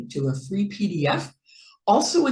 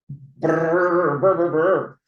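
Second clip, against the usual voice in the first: louder brr, brr like shivering in the cold; 4 dB louder, slower than usual. Acted. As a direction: shiver through it as if freezing.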